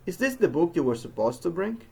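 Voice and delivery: "Is this the book" question climbing to the end on "bring"; rising question tune. Shown as a demonstration. Teacher showing we're asking a question, rise on "bring". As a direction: lift the voice up on "bring". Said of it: "voice goes up at the end".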